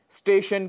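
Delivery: स्टेशन said as "isteshan"; mispronunciation with added i. Shown as a demonstration. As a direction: put a short i before स्टेशन. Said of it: "जो कि गलत है". (स्टेशन को इस्टेशन कहना, गलत उच्चारण)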